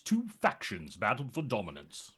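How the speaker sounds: low, soft epic narrator; famous trailer line. Shown as a demonstration. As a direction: intone it like the game's opening narration. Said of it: "do the famous phrase".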